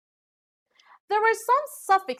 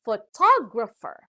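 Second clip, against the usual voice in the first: "photographer" with TOG punched loud; "photographer" demonstrated with a strong, raised TOG; stress on second syllable; 5 dB louder than usual. Shown as a demonstration. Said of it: "stress is given to the second syllable".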